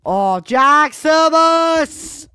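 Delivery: drawn-out high excited shout; yelling at the play, stretched. Real, not acted. Gamer shouts at a great play, drawn-out high excited yell. Reacting to a big play in game.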